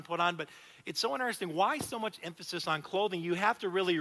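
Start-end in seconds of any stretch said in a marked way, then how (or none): none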